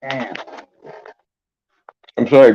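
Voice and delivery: usual speaking voice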